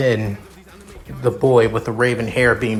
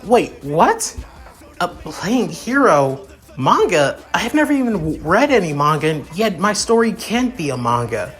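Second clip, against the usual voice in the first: higher-pitched, mildly indignant disbelief; baffled protest; 6 semitones higher than usual. Acted. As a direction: protest it, a touch taken aback. Character protests being called manga fiction.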